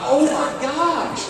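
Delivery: high, slow drawn-out oh my gosh; freaking out as the deer walks off; panicked. Retold or imitated. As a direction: let the panic stretch out.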